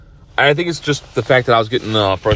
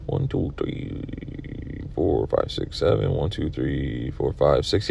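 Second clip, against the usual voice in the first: whispered counting; 6 semitones lower, 9 dB quieter, slower than usual. Real, not acted. Counting balls under breath.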